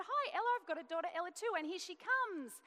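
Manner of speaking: breezy friendly introduction, words tumbling out; mother greeting a friend's daughter, bright and quick; bright cheerful greeting. Retold or imitated.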